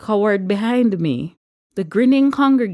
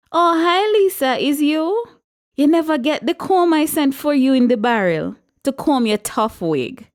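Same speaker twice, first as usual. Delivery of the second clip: much higher, sugary mock greeting then a jab; catty taunt; 9 semitones higher than usual. A narrator's reading. Regina needling Lisa across the church, catty and mocking. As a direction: fake sweetness hiding an insult.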